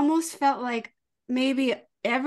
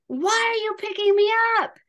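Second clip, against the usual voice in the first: put-on high, louder whiny kid question; 10 semitones higher, 4 dB louder than usual. Mom imitates her son at pickup, high and whiny. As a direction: squeak it out like a put-out child. Child voiced in mom's story.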